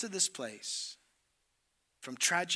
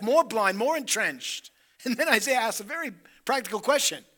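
higher, louder acted dialogue; fervent preaching; 6 semitones higher, 12 dB louder than usual. Preacher acting out God and Isaiah, fervent. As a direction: preach it with building fire.

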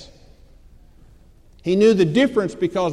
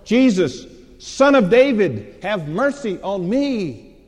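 loud, high, drawn-out plea; shouting out; 5 semitones higher, 5 dB louder, slower than usual. Retold or imitated. Blind man refusing to be silenced, shouting to Jesus. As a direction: shout each phrase slowly, stretching the last. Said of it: "began to shout out all the more".

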